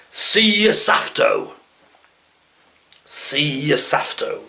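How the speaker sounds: slow East Midlands dialect phrase; East Midlands accent. Retold or imitated. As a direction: drawl out safto, East Midlands. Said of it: "That's like afternoon".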